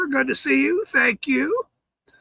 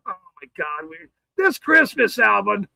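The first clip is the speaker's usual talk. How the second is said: ordinary talk, nothing marked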